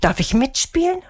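high, eager-sounding question; calling out. A narrator's reading. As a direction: call it up to someone inside. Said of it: "rief Sandra".

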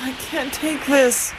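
slow, freezing complaint; chilled to the bone; shivering with cold. Acted. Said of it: "They were all freezing".

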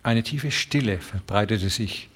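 usual speaking voice

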